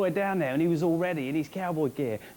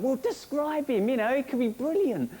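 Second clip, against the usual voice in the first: eager urging; 7 semitones higher than usual. Retold or imitated. Urging mate to describe visitor.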